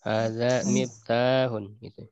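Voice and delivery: slow, miftahun with the ta held two beats; long a in miftahun. Shown as a demonstration. Teacher models miftahun with a long vowel. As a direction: stretch the a of miftahun to two counts. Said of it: "miftah panjang dua harokat".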